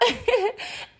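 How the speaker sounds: laugh. Real, not acted. Laughing mid-rant.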